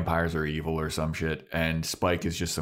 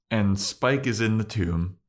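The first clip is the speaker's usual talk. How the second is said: ordinary talk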